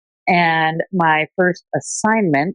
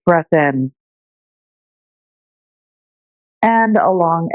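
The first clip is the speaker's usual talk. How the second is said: unhurried meditation teacher voice with long pauses; calm, measured breathing-meditation delivery; guided meditation. Shown as a demonstration. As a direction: guide listeners gently through their breath, leaving space between phrases.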